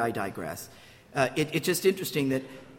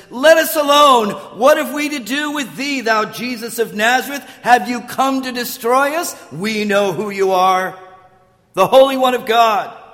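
loud, raised demoniac outcry; crying out; 4 semitones higher, 7 dB louder than usual. Acted. Demon-possessed man interrupting Jesus's teaching, crying out loudly. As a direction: cry out in loud protest. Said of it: "interrupts and cries out".

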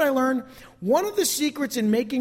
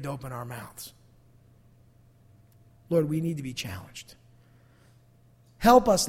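closing prayer; 2 semitones lower than usual. Acted. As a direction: lead the church in heartfelt prayer.

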